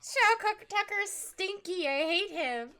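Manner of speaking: high, slow mock-disgusted whine; mock childish disgust. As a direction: whine it like a sulky kid. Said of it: "I was too busy being like".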